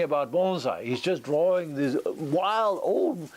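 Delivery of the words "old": ordinary delivery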